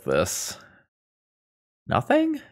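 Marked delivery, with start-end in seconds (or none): 1.9-2.4 incredulous squeak. Real, not acted. Incredulous at a useless hand.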